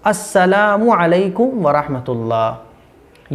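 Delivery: slow, elongated "अस्सलामु अलैकुम वरहमतुल्लाह"; correct full salam. Shown as a demonstration. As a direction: join the clear words smoothly, holding each long vowel. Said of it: "ये है सही।". ("अस्सलामु अलैकुम वरहमतुल्लाह" धीरे, सही)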